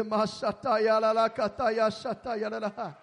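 speaking in tongues. Real, not acted. Glossolalia in prayer.

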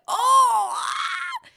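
sudden high yelp of disbelief; shocked shout. Retold or imitated. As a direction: cry out as the punch freezes. Stream freezes mid-punch.

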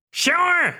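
high, hearty laughing "Sure!"; hammy jolly cackle; good-natured laugh. Acted. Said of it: "Volo laughs good-naturedly".

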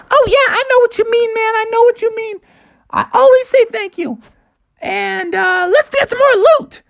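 put-on very high little-kid voice; eager little Junior Bear. Junior Bear answers his brother.

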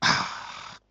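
soft, slow breathy-toned hush; God's whisper, softly shown. Shown as a demonstration. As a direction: let the words fall to a hush. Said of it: "When he whispers".

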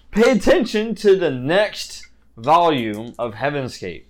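trailer-style hype read; announcer voice. Acted. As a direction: announce it like a show teaser. GM announces the next volume.

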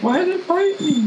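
high, whiny mock question; mock clueless guy. Mock voice of rattlesnake kicker.

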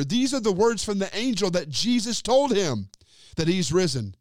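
ordinary talk

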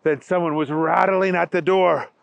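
usual speaking voice

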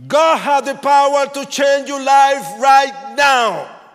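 high, very loud, drawn-out preaching shout; screaming street preacher. Retold or imitated. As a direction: bellow it over traffic and crowds. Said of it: "There's a man raising his voice, screaming so, so hard".

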